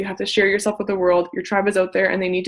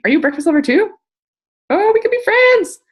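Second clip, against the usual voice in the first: higher and louder, rising to a squeal; bubbly, eager friendliness; 11 semitones higher, 5 dB louder than usual. Shown as a demonstration. Coach shows members a friendly reply, sung out high and eager. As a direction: gush it like you've found a new friend.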